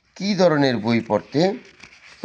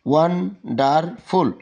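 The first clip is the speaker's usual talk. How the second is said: "Wonderful" slowly in three beats, won... der... ful; broken into syllables; 3 semitones higher, much slower than usual. Shown as a demonstration. Teacher showing students a three-part word, "wonderful" syllable by syllable. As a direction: pause after each syllable of "wonderful". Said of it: "এটা তিনবারে".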